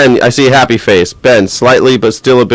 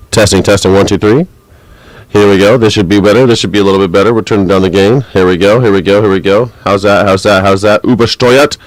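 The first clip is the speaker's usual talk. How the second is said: ordinary talk, nothing marked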